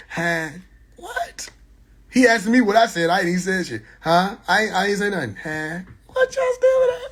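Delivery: ordinary talk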